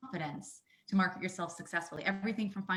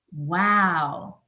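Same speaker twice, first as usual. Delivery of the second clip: ordinary delivery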